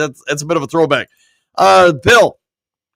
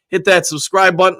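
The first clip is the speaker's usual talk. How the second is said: usual speaking voice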